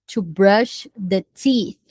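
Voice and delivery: slow, clear teacher model line; slow classroom demonstration; slow model for repetition. Shown as a demonstration. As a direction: spell it out slowly for students to copy.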